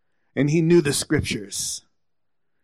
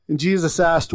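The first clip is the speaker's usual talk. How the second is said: ordinary delivery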